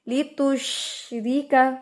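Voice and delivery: लितुशरिका slow, light रि; correct recitation. Shown as a demonstration. Teacher gives students the correct reading. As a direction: say it the right way, gently. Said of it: "हम पढ़ेंगे इसको".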